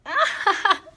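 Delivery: high burst of giggling; laughing. A narrator's reading. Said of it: "I fell back on my bed laughing".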